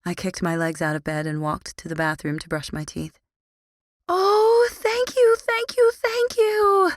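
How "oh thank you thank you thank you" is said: very high, loud gushing thanks; excited calling. Acted. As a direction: squeal your thanks. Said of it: "Lily called".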